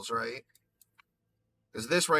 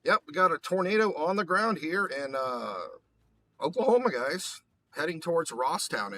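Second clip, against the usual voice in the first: high, loud excited announcing; 5 semitones higher, 5 dB louder than usual. Real, not acted. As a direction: call it out with rising alarm. Tornado spotted on live radar.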